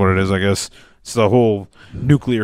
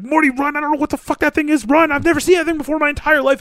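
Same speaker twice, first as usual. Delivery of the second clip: high, fast Rick impression; faster than usual. Podcaster imitating Rick fleeing a giant creature, high and fast. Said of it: "he's like".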